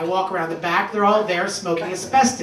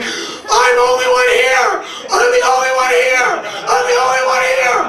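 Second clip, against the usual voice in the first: panicked yell; 5 dB louder than usual. Retold or imitated. Alone on an empty Michigan road, a high panicked yell. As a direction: scream it out into the empty woods.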